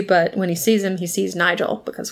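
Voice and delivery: normal talking voice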